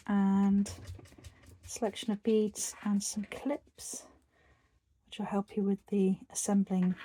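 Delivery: a calm whisper guiding the stitches. Acted. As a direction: murmur each step gently, like close-up ASMR. Whispered tutorial walkthrough.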